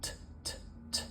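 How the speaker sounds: "T" as a voiceless puff, not the letter name; crisp aspirated T. Shown as a demonstration. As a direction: tap out a crisp, airy "t" sound alone. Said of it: "making that T very crisp".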